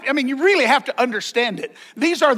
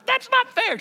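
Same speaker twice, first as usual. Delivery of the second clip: much higher pitched complaint; high whiny protest; 13 semitones higher than usual. Retold or imitated. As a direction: squeal it like a sulking crowd. Crowd grumbling at the parable.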